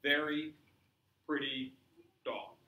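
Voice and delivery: broken up, word by word with pauses; halting broken speech. Shown as a demonstration. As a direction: stop and start on each word. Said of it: "what would come out would be".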